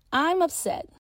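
a hint of a schoolgirl asking her friend; Tia, girl voice. A narrator's reading. Tia calmer.

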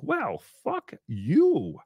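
high, slow, swooping dismissal; sing-song it, stretching both words; exaggerated drawn-out kiss-off; mock sweet retort. Her retort to the threat.

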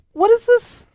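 high bewildered "What is this?"; baffled. Pretend. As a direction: sound totally confused. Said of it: "be like".

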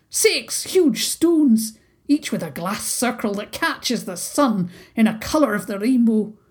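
put-on chattering haggis in a broad Scots accent; dramatic little haggis with a thick Scottish accent. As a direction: gossip it like an old Highland beastie. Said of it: "his thick accent".

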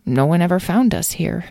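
ordinary delivery, nothing marked

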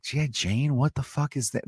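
quiet, mildly mocked bafflement; soft, puzzled aside as an imagined youngster; baffled youngster. Imagined young person hearing an old reference, mock baffled. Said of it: "anyone under the age of twenty-three".

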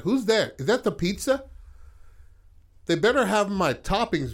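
high, loud dad calling out; yelling dad. Retold or imitated. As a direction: imitate an irritated dad yelling from another room. Voices Barrett's dad yelling from background.

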